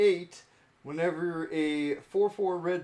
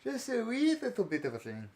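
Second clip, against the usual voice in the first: high-pitched Irish lilt; put-on Irish accent, pitched up; 7 semitones higher than usual. Shown as a demonstration. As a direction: show off a playful Irish accent. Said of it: "I can do Irish".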